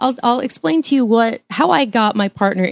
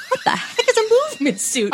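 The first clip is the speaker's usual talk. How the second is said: incredulous; 12 semitones higher than usual. Real, not acted. Baffled by the villain's line.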